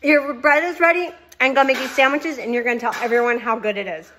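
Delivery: real thrilled, loud gushing; emotional excitement. Real, not acted. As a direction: gush over the bread with real excitement. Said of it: "I am so emotional".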